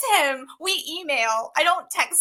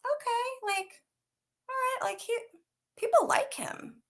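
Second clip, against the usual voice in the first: high, soft musing; small high voice, reluctantly accepting; puzzled inner thought; 13 semitones higher, 6 dB quieter than usual. Retold or imitated. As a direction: think aloud softly, pitched way up. Acts out her puzzled thoughts.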